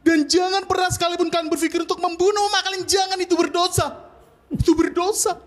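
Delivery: high, insistent exhortation; impassioned plea. Acted. Comedian urging those who still have mothers, impassioned. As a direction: plead urgently, pitch held high throughout.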